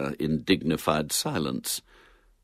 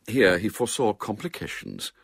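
usual speaking voice

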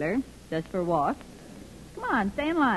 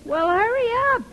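high, louder call; calling back; 11 semitones higher, 7 dB louder than usual. Acted. Myrtle calling back to Clarence, louder. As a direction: hurry him along.

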